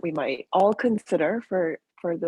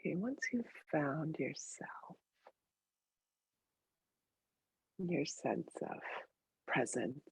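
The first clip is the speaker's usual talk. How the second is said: very soft, sparse meditation voice; hushed guidance, each phrase left to settle in silence; guided meditation; 12 dB quieter than usual. Real, not acted.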